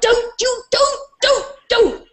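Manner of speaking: high, urgent begging; begging a producer not to introduce her; panicked pleading. Retold or imitated. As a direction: plead with him to stop.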